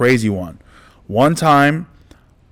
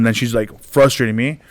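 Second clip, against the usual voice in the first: ordinary talk, nothing marked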